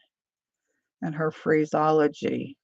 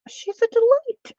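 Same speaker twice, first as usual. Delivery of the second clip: "She's a delight" sung out much higher and louder; gushing delight; 6 dB louder than usual. Shown as a demonstration. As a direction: squeal it with joy. Repeats the compliment twice.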